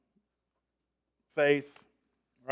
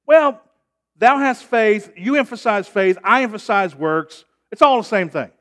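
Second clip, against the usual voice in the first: higher, louder voice of a smug objector; mocking objector; 7 semitones higher, 10 dB louder than usual. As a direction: play the wise guy who lumps faith and works together. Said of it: "they're gonna say this".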